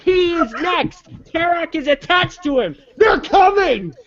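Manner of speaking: high, excited battle cry; rally the troops, thrilled; excited shouting. Real, not acted. Hyped about his figures.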